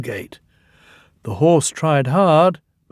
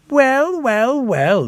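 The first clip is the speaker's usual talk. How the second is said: high, drawn-out teasing; mock surprise; 12 semitones higher, slower than usual. A narrator's reading. Percy teasing Thomas after his fright. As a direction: savour the moment, gleeful. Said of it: "he exclaimed".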